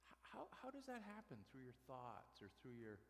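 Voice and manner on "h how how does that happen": high, stammering newcomer voice; puzzled new guy. Pretend. Preacher pretending to be the new guy, high puzzled voice. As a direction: pitch it way up, baffled and hesitant. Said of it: "let's pretend I'm just the new guy here".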